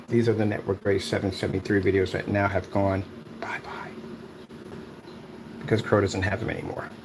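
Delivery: hushed mock whisper about the missing videos; whispered taunt; whispered aside. Real, not acted. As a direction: drop to a sly whisper. Mocking gone-bye-bye aside.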